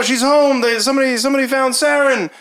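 high, excited cries; excited townsfolk. Acted. Townspeople think Saren is home, excited cries. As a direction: call the news from door to door.

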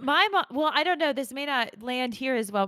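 ordinary delivery

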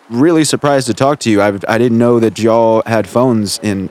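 ordinary talk

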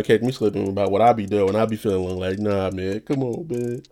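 usual speaking voice

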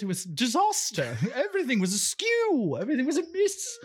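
high-pitched, swooping British lament; hammed-up British dismay; put-on British accent. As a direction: play the appalled Brit in a hammy accent. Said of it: "stop doing the accent".

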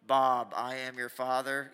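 low, loud, slow mock villain; drawn-out, deep, hammed-up movie line; joking movie quote. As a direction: intone it as a jokey villain impression. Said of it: "I just heard it quoted jokingly".

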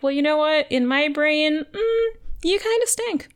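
mock retort. Acting out Maria's retort to Liz.

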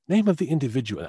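high-pitched mock British actress; attempted British accent. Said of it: "I can't do a British accent".

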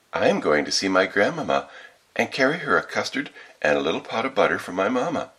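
put-on little girl. A narrator's reading. Child telling the wolf about her errand, little-girl voice. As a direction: answer like an artless child in a tale.

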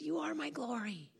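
soft, hushed reassurance; gentle voice of God. Retold or imitated. The Lord repeats himself to calm the preacher, gentle hushed voice. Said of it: "And he said".

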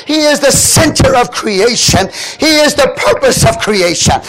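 loud, high, ringing sermon shouts; full-force preaching. Acted. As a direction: preach it out to the whole house.

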